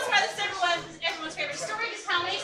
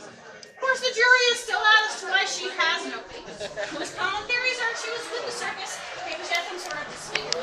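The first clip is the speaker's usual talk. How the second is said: tour guide narration; 3 semitones higher, 6 dB louder than usual. Acted. Ghost tour guide presenting.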